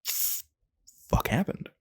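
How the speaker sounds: slow, breathy, bewildered; stunned disbelief. Retold or imitated. Visiting the job, stunned by the vibe. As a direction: let it drag out in shock. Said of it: "And you're like".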